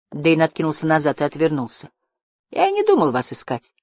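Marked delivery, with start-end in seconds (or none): 2.5-3.7 high, offended retort. Acted. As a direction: brush the question off. Dane turns away.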